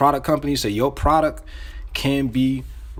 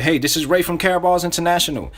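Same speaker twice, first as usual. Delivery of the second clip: upbeat, higher-pitched sales greeting; enthusiastic; 6 semitones higher, 3 dB louder than usual. Shown as a demonstration. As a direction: open the cold call with real energy. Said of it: "you gotta say this with enthusiasm".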